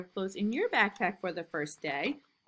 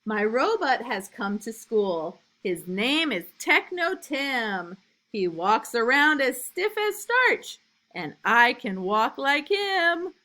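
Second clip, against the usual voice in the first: lively, louder storybook reading; reading to children; 3 semitones higher, 7 dB louder, slower than usual. A narrator's reading. Teacher reading a picture book to new kindergartners, lively and loud. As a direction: read it big and bouncy for little kids.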